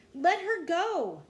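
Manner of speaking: high, loud, drawn-out demand; pleading yell. A narrator's reading. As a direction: yell slowly for her release. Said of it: "yelled Jack".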